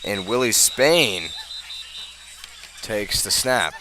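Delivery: ordinary talk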